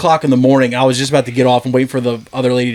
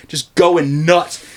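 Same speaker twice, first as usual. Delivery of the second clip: ordinary delivery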